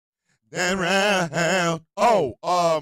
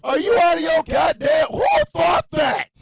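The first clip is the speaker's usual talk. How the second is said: loud, higher, exasperated yell; incredulous shout; 8 semitones higher, 6 dB louder than usual. Real, not acted. Streamer scolding MAGA chat, loud incredulous yell. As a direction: yell in disbelief. Outraged rant.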